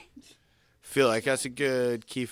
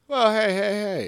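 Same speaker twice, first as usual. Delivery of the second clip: raised, loud cutting-in protest; higher, louder objection; 7 semitones higher, 7 dB louder than usual. Real, not acted. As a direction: jump in high and loud to defend a friend. Protests on behalf of absent Hans.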